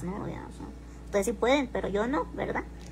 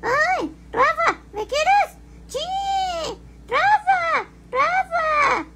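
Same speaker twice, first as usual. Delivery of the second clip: very high, louder squealing voice calling Rafa; shrill-free but strongly raised, loud mock voice of a lady begging Rafa; mocking impression of the lady; 7 dB louder, much slower than usual. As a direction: mimic a clingy lady pleading for Rafa's love, pitched way up. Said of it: "Ustedes sí pueden de".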